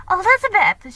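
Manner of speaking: loud eager call across the room. Acted. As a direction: call her friend over, bright and loud. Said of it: "she called".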